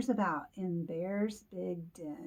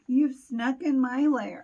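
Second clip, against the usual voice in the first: loud, higher-pitched mock indignation; grumpy Bear; 5 semitones higher, 10 dB louder than usual. Grumpy Bear scolds the intruders, loud mock indignation. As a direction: protest loudly as a woken bear. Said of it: "so now he is grumpy".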